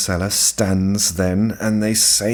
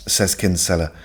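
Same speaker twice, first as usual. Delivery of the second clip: normal talking voice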